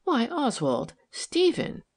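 high, tender plea, rising on the name; gently reproachful, pitched well up; beseeching hurt. A narrator's reading. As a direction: appeal to your husband, a little wounded. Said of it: "a little beseeching hurt and tenderness".

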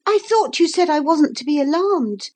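put-on high, earnest young girl; a girl reassuring herself in the flood; little girl voice. A narrator's reading. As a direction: speak up as a sensible child. Narrator voicing Lucy.

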